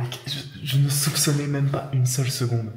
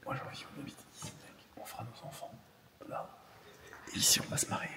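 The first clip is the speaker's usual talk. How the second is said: whispering; 9 dB quieter than usual. Acted. Blague romantique chuchotée.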